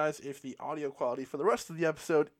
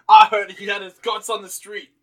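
louder, hammed-up bad British accent; 10 dB louder than usual. Host attempting a British accent, failing on purpose. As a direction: fake a British accent badly, loudly. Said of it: "I can't do a British accent".

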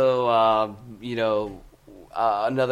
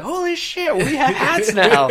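high, loud, slowed-down burst of excitement; excited shout; 13 semitones higher, 8 dB louder than usual. Retold or imitated. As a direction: yell it out like the best news ever. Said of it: "I was like".

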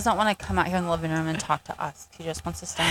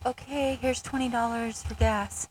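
overly sweet brush-off; 5 semitones higher, 4 dB louder than usual. Retold or imitated. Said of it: "I'm just like".

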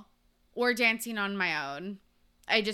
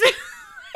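high laugh; 6 dB louder than usual. Real, not acted.

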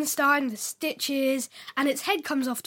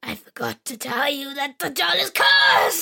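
put-on creepy old woman; 4 dB louder than usual. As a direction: warn like a spooky old witch.

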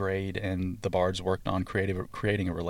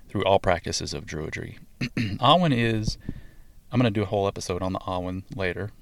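ordinary talk, nothing marked

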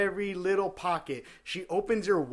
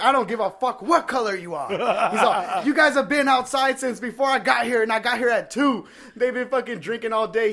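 high loud tirade with laughter breaking in; mock shouting cashier; 7 semitones higher, 9 dB louder than usual. Cashier yelling at the customer, half laughing. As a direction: rant back at him laughing.

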